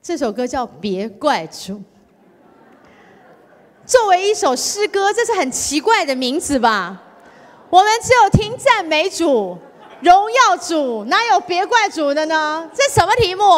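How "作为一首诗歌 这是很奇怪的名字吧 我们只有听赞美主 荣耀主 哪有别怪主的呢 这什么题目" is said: high and loud, laughing along; playful exclaim. Real, not acted. Preacher jokes to the church about the title, high and playful. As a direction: tease the audience about the odd title.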